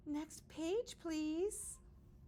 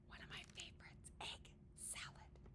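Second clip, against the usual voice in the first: soft confiding whisper; whisper aside; 19 dB quieter than usual. Real, not acted. Reader confides a favorite, whispering. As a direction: share it like a secret. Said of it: "reader whispers aside".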